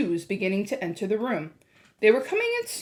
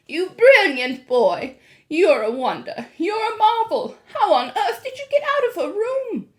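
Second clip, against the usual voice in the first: delighted praise; 9 semitones higher, 6 dB louder than usual. A narrator's reading. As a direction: praise him warmly. Grandmother praising.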